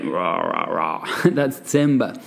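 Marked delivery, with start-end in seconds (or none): 0.0-1.1 deep, low rumbling growl of a voice; Sean Connery. Retold or imitated. Showing students what timbre means in a deep growl. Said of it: "So think of Sean Connery".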